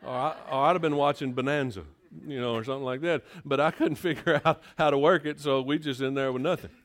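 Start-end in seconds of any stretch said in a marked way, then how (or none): 3.4-5.4 chuckling through the words; laughing. Real, not acted. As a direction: laugh at yourself as you say it. Laugh heard on this retold line.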